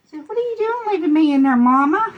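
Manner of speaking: high, fast dog's voice complaining; voicing the dog. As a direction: speak for the dog, whining at Mama.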